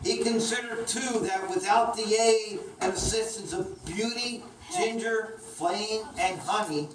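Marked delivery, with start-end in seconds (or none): none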